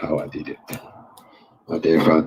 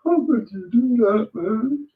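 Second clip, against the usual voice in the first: higher, squeezed voice with a fake sob; mock crying. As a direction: ham up a teary sob, half joking. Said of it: "it doesn't suit me crying".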